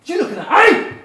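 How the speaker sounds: loud high threatening challenge; aggressive stranger. Acted. Speaker playing a nasty stranger confronting you, angry shout. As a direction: shout it in someone's face.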